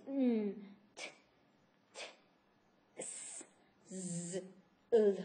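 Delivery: isolated consonants again, s unvoiced; sound demo. Shown as a demonstration. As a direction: model each sound slowly and apart. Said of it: "Suena raro".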